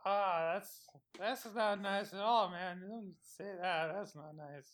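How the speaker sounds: low, very soft, slow protest; wounded and barely audible; hurt. Acted. Hurt by the hateful note.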